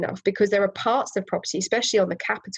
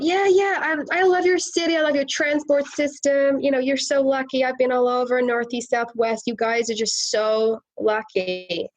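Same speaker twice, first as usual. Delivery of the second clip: put-on American accent; 5 semitones higher than usual. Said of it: "this is my American accent".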